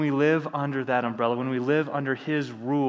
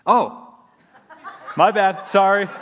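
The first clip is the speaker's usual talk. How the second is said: laughing; 7 semitones higher, 8 dB louder, slower than usual. Real, not acted. Embarrassed after calling wrong person.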